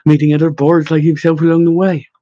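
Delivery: plain reading voice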